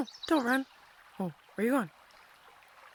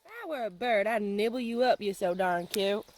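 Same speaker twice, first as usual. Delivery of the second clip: ordinary delivery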